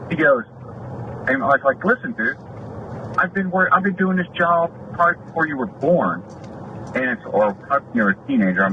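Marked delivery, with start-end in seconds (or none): 1.8-6.2 retold indignant boast. Retells pulling seniority in a quarrel.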